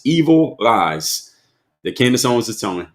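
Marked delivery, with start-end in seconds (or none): 0.0-1.3 "evil lies" drawn out very slowly, each word weighted; slow emphatic quote. Shown as a demonstration. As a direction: lean hard on "evil lies", stretching it out. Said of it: "in quotes".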